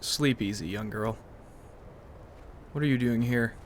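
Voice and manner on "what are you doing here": ordinary delivery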